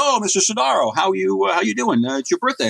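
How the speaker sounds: mock gushing greeting, pitched high; chirpy principal. School principal greets a parent at his birthday line-up, chirpy. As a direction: play the principal's high-voiced hello.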